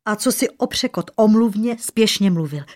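usual speaking voice

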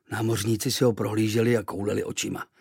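ordinary delivery

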